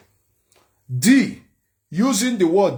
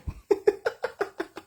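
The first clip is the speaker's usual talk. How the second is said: high laugh; 3 dB quieter than usual. Real, not acted. High cackle.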